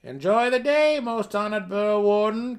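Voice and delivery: high, loud farewell call; calling from the door. Acted. Gnome at the door calling to Burrow Warden, loud high cry. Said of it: "came a final cry from the outside door".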